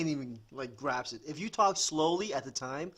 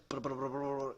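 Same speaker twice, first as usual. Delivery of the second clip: imitated fast foreign chatter; rapid-fire babble. Man imitating how they talk Spanish, rapid-fire babble. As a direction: rattle off nonsense syllables at speed. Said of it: "wicked fast".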